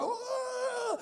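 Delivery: high drawn-out imitated crowd cheer; crowd going crazy. Imitating the community going crazy, high cheering. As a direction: whoop like a wild crowd. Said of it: "The community's going cra".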